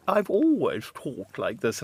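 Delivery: slow, haughty upper-class English accent, imitating mother; mock posh English accent, dignified and affronted. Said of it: "terribly affected and posh".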